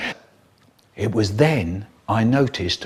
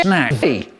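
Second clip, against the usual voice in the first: ordinary talk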